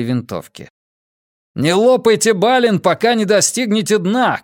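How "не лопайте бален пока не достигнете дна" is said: high, raised shouted order; urgent shout. A narrator's reading. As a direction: call out a warning to the group. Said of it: "крикнул Каз".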